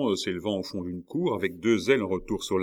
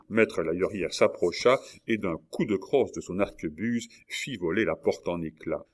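plain reading voice

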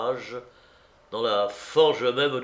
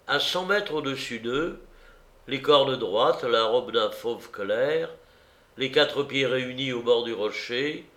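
plain reading voice

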